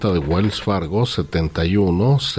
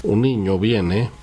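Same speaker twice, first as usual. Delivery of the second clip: usual speaking voice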